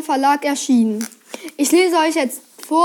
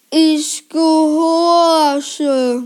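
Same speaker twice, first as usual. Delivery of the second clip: very slow, droning trance voice; entranced murmur; 3 dB louder, much slower than usual. A narrator's reading. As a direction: stretch each syllable like a hypnotised servant. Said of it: "murmelte Herr Krupp". (willenlos gedehnt, wie hypnotisiert)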